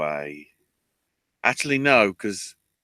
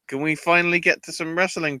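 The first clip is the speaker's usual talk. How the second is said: higher, fast exasperated plea; 8 semitones higher, faster than usual. Retold or imitated. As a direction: beg impatiently through the commercial break. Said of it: "and I'm like".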